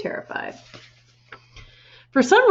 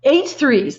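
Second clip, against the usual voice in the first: loud, higher shout; 6 semitones higher, 6 dB louder than usual. A narrator's reading. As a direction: bark it at the boy. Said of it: "the Trunchbull shouted".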